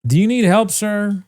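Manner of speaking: polite helper voice. Retold or imitated. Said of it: "I'm like".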